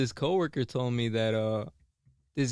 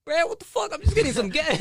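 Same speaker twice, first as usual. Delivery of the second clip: much higher, louder bewildered yell; outraged yell; 7 dB louder than usual. Retold or imitated. Attendant yelling at a customer wanting soda, outraged. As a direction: yell it like you can't believe it.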